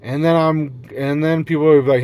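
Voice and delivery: ordinary talk, nothing marked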